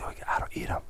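whispered, hushed aside. Retold or imitated. Coworker whispering that he doesn't eat the bars. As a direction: whisper it like a secret. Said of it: "He goes, he goes".

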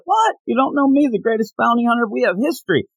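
mock-outraged, high-pitched retort; indignant disbelief. Fan acts Boba Fett affronted at being forgotten, indignant. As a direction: jump up high on a disbelieving "what".

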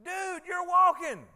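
high, loud, slow amazement; amazed exclamation. Retold or imitated. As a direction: marvel out loud at the healed man. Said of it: "why couldn't they say".

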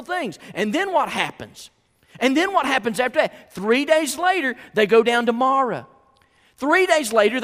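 urgent high-energy preacher; forceful preaching. Acted. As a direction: drive the story home from the pulpit.

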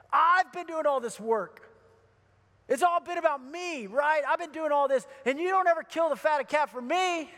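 high, aggrieved protest; indignant complaint. Retold or imitated. Older son protesting unfairness to his dad, acted indignation.

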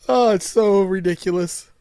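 usual speaking voice

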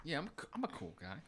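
voicing Harry acting cool; smug boast. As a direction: brag lazily like a cool kid.

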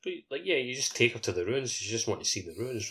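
ordinary talk

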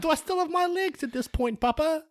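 put-on innocent little boy. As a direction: ask papa like a trusting small boy. Orphan asks papa, kid voice.

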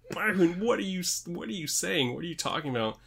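high-pitched, incredulous imitated questioning; imitating Corey Graves. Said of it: "Corey Graves starts to say something else and then realizes".

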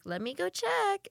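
high, slow placating server voice; customer-service voice. Retold or imitated. Retold cheery customer-service reply to diners.

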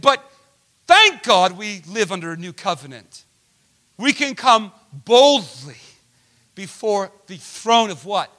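loud, high, rising sermon voice; preaching. Acted. Pastor preaching hard to the congregation. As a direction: preach it with fervor. Preacher building to full force.

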